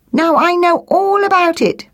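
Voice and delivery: high, sustained sing-out; cocksure blackbird. Acted. Blackbird to the magpie teaching nests, high and cocksure. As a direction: pipe up like a know-it-all bird.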